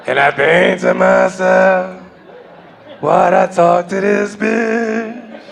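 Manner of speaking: stretched-out jazz crooner parody, very slow. Retold or imitated. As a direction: croon it out slowly like an old jazz singer. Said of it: "I turn into fucking [name]".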